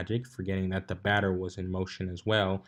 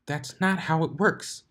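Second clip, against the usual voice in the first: a touch of annoyed unicorn; mildly irritated, rubbing a sore cheek; Twilight's voice; 7 semitones higher than usual. A narrator's reading. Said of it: "she said, rubbing her tingling cheek".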